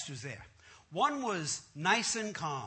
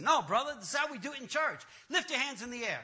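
raised pitch, mimicking the pastor; insistent preacher; 4 semitones higher, faster than usual. Pastor correcting the man on church practice, insistent. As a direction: overrule him with preacherly force.

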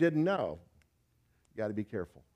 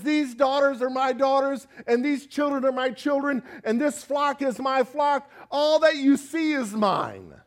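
higher, loud, possessive claim; defiant Laban; 8 semitones higher, 5 dB louder than usual. Acted. Laban answering Jacob's rebuke, higher and defiant. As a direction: stake your claim to everything. Preacher voices Laban's reply.

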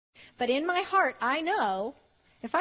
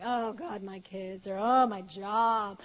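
low, slow mock whine; hammed-up grumbling, trailing lower; grumbling complaint; 4 semitones lower, slower than usual. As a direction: drag it out like a weary moaner. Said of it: "we keep grumbling, and we keep complaining".

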